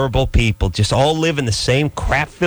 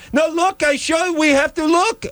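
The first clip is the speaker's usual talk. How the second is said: much higher put-on voice of a pestering neighbor lady; neighbor woman calling. Neighbor lady summoning Adam to look, in a much higher put-on voice. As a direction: squeal for Adam like a nosy neighbor.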